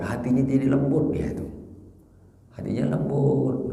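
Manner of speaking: usual speaking voice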